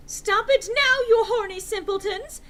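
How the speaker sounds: very high, loud scolding shout. Acted. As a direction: bark at them to stop. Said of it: "shouted Professor McGoggle".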